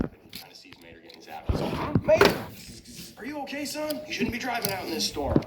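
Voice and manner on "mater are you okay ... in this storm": high-pitched concerned cartoon lawman; Sheriff. Sheriff checks on zapped truck, high character voice. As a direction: call out the name loudly, then ease off, high. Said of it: "Sheriff is over behind his speed trap".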